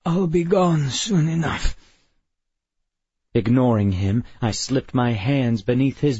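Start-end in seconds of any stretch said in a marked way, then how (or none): 0.0-1.8 croaking. A narrator's reading. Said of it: "he croaked".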